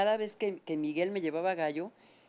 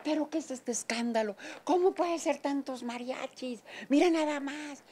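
outraged father; 7 semitones higher than usual. Retold or imitated. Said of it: "mi papá furioso".

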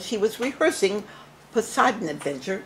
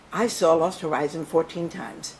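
ordinary delivery

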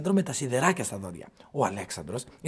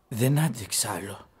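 ordinary delivery

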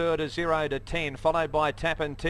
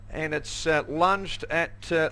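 ordinary talk

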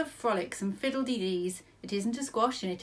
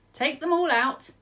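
put-on 1 firm old man; 7 semitones higher, 8 dB louder than usual. A narrator's reading. Wise old man decides.

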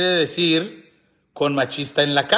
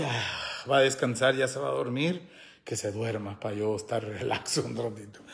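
mock weary husband; 6 semitones lower, 9 dB quieter than usual. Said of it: "Yo creo el vato mejor dice".

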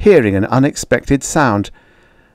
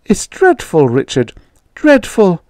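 frightened mother; 10 semitones higher than usual. Acted. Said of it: "My mother looked frightened".

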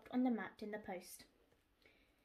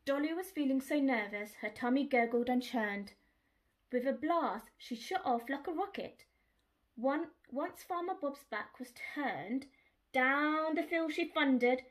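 ordinary delivery, nothing marked

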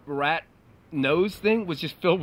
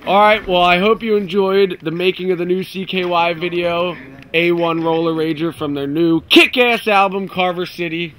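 louder hyped announcer read; announcer outro; 6 dB louder than usual. Acted. Band member hyping the new video as host.

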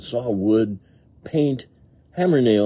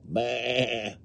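higher, bleated "bah"; lamb bleat; 4 semitones higher, 4 dB quieter than usual. A narrator's reading. Lamb running to mother sheep, high bleat. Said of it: "says the lamb as it runs".

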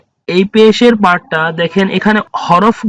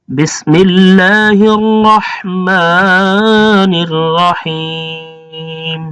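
Quranic recitation; much slower than usual. Real, not acted. Opening recitation before lesson.

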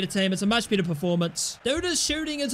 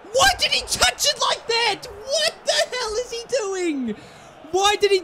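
outraged yell; 12 semitones higher than usual. Real, not acted. Player's bad touch, outraged.

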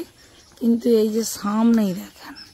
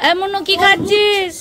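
high, raised call to a deaf listener; to someone hard of hearing; 7 semitones higher than usual. Real, not acted. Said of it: "ঠাকুমা তো কানে শুনতেই পাবেনি".

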